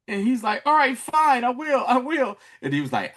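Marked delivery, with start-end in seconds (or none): 0.6-2.4 high-pitched, flustered surrender; imitating a panicked boy, voice up; caving in. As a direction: give in like a scared kid. Frightened boy gives in.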